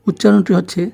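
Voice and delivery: usual speaking voice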